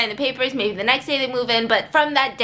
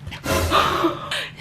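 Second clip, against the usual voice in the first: mock gasp; 4 semitones higher than usual.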